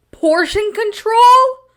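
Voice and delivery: high, slow, stunned question; outraged disbelief. Real, not acted. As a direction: repeat it as if you can't believe it. Outraged repeat of remark.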